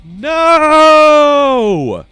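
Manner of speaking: long, loud, high wail of no; drawn-out howling cry; anguished scream. Real, not acted. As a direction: scream it out in defeat. Character dies in the game.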